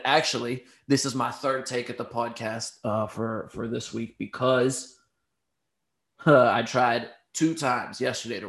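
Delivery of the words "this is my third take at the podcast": normal talking voice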